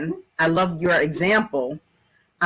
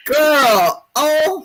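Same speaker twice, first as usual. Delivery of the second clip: excited exclamation; 6 semitones higher, 6 dB louder than usual. Real, not acted. Reacting to the sermon.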